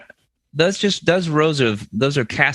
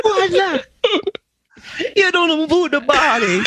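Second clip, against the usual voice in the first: put-on higher comic Italian accent; 12 semitones higher than usual. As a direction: lay the Italian on thick, laughing. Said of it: "I'm doing an accurate Italian accent".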